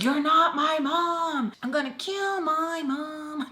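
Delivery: high, long-drawn wail; mock screaming. Narrator screaming through the door, hammed-up mock scream. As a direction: stretch it into a hammed-up high holler. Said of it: "And I'm, like, screaming".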